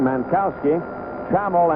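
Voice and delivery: normal talking voice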